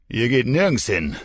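loud mocking jeer; sneering. Drunk man jeering at the leaving mother, loud sneer. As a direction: throw it at her with scorn. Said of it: "höhnte Tommy".

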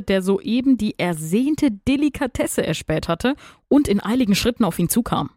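ordinary delivery

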